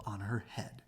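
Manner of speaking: hushed aside; whispered. A narrator's reading. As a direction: whisper it like a secret. Piece falls on Chicken Licken.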